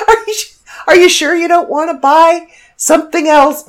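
high, pleading cashier. Retold or imitated. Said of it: "And she's like".

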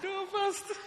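high voice full of giggles; high, giggly imitation of a woman; imitated laughing. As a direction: answer through mock laughter. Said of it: "she started to laugh".

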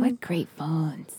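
slow wistful whisper; nostalgic whisper. Real, not acted. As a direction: breathe it out fondly.

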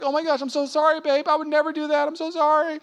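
high, soft, pleading sorry; mock grovelling apology. Husband apologizing to his wife in a high, soft, sorry voice. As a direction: beg forgiveness in a small high voice.

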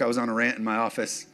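ordinary delivery

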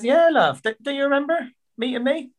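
high and chirpy, teasing the guest; Lynn's voice. Retold or imitated. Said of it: "And she said".

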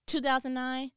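ordinary delivery

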